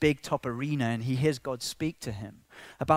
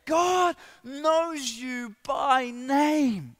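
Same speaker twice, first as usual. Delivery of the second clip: high, loud, rousing pulpit voice; impassioned preaching; 11 semitones higher, 6 dB louder than usual. Real, not acted. Preacher proclaiming to the congregation that God knows them, impassioned. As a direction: preach it at full force, building high.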